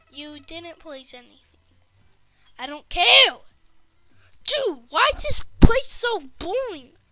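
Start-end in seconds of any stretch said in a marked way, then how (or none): 2.6-7.0 loud, higher angry yell; angry shout. Acted. Kid yelling at the boring game shop. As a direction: blast it out, fed up.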